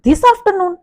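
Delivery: slow, high questioning "this afternoon". Shown as a demonstration. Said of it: "थोड़ा सा वो high हो गया".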